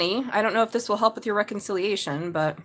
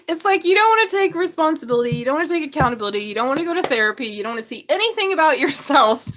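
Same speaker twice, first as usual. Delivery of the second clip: exasperated rant; 5 semitones higher, 4 dB louder, faster than usual. Retold or imitated. Ranting at family.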